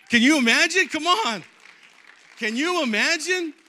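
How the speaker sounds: loud, high, amazed outburst; excited exclamation. Real, not acted. Preacher marvels at angels backing him, excited shout. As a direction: call out to the whole crowd.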